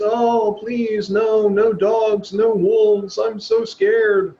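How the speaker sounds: high, slow, trembling plea of a frightened sheep; scared little sheep. Retold or imitated. Teacher voicing the timid sheep, high and slow with fear. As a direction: whimper it like a sheep begging the wolves away. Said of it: "you're no longer the little sheep that's".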